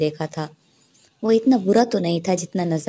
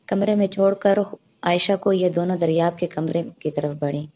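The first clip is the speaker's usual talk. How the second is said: ordinary delivery, nothing marked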